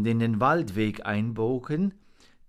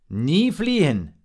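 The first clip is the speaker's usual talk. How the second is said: raised, loud admonition; emphatic order; 6 semitones higher, 8 dB louder than usual. A narrator's reading. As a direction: drive the rule home loudly. Raven gives emphatic order.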